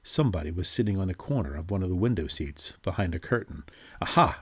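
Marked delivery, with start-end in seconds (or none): 4.0-4.4 triumphant aha; surprised exclamation. A narrator's reading. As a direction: pounce on it with glee. Finds hidden person, exclaims.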